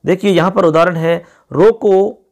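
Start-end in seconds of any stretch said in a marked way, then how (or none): 1.5-2.2 रोको pitched up and louder, then a stop; रोको as a command on its own; रोको set apart. Shown as a demonstration. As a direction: hit रोको and halt as at a comma. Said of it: "comma लगा दिया".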